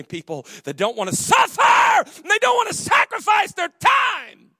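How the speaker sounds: slow, loud preacher's shout; shouted sermon line. Acted. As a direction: hammer it home slowly, full voice. Preaching at full force.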